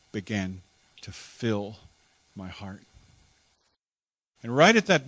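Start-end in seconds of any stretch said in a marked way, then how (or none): none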